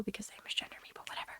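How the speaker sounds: whispered, dismissive aside. Real, not acted. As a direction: let it fade under your breath. Trailing off into a mutter.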